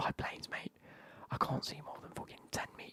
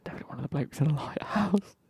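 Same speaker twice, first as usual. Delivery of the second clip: sudden outburst; 12 dB louder than usual. Real, not acted. Bursts out with lighthouse idea.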